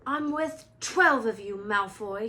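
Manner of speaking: Neville forcing out a defiant stammer. A narrator's reading. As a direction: Neville standing up to Malfoy, stammering loudly. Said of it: "he stammered".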